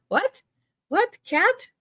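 high squeaky questions to a pet; pet talk. Real, not acted. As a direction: ask the pet up high, playfully. Talking to pet hearing "cat.

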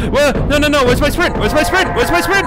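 high, loud, frantic; panicked yelling. Real, not acted. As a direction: yell in real panic as a monster closes in. Monster chasing him.

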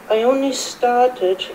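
usual speaking voice

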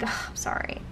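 quick sheepish whisper; soft retold aside, whispered; whispered apology. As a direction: whisper the sorry under your breath. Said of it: "I'm like".

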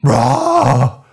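loud high scream of pain. Acted. Worker screams as the customer bites. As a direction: scream as teeth bite in. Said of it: "I screamed".

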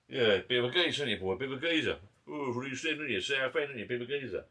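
lightly voiced teasing geezer; mock teasing bloke. The Northerner keeps teasing him about being a geezer, lightly mimicked. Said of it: "he's trying to be funny".

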